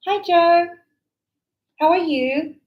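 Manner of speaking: 1 friendly greeting. Shown as a demonstration. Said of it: "I walked in".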